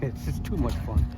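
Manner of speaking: ordinary delivery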